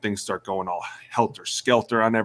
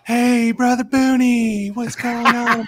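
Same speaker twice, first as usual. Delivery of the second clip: high, slow, singsong trainer voice, much higher and louder; trainer's greeting; 14 semitones higher, 6 dB louder, slower than usual. Retold or imitated. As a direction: greet the injured player like a cheery preacher. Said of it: "he comes over".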